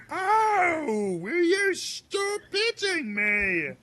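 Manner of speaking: high, slow whiny cry; hammed-up yelp of a beaten servant; high pained protest. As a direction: cry out as if being struck. Character protests being hit.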